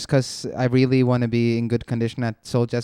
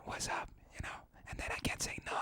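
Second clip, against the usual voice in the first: lost voice whisper; 20 dB quieter, faster than usual. Pretend. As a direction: voice gone, only breathy croak. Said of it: "I'll wake up like".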